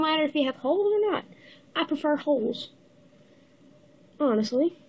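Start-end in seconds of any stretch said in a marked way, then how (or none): none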